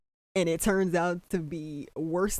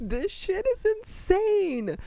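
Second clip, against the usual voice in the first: pitched way up and slowed, stunned; high-pitched disbelief; 13 semitones higher, slower than usual. Real, not acted. Viewer reacting to the show's wild twist, high slow squeal. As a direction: squeal it out slowly in shock.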